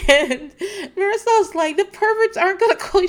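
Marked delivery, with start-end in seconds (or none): 1.8-3.1 high, louder mock reassurance; imitating a reassurer. Marisol answering Mama Elsa on the phone, mock-reassuring. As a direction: play the insistent friend, pitched way up.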